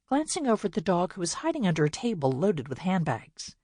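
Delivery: usual speaking voice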